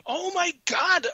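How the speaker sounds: high, startled exclamation; blurting it out in disbelief; shocked gasp. Retold or imitated. Retelling seeing a ghost, high shocked cry.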